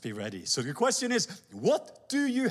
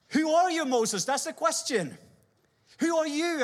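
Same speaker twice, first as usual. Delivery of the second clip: high, loud who are you; searching question; 8 semitones higher, 3 dB louder than usual. Acted. God asking Moses who he is, high and pressing.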